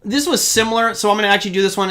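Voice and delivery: ordinary talk, nothing marked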